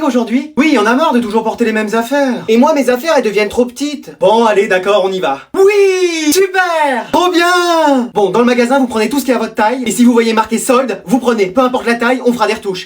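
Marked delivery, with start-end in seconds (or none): 5.5-8.1 shouted high yes, super; excited cheer. Acted. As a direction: cheer like kids promised new clothes. Kids cheering shopping trip.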